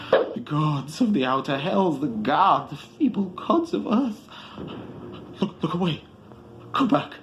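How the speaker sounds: high, loud horrified wail; anguished cry. A narrator's reading. Barzai warns Atal away from the abyss, high anguished cry. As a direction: cry out warning in horror. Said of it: "a cry wherein reverberated the horror".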